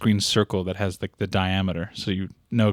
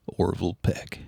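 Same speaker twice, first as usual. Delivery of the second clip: a bit lower, deep croon; going low; 5 semitones lower, 6 dB quieter than usual. Pretend. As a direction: try for a deep voice. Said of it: "Yeah, go low".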